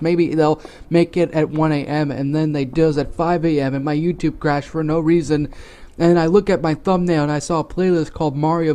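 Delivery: low, flat monotone read of a bad story; deadpan story reading. Narrator reading the story to viewers, flat monotone. Reads the fan story aloud.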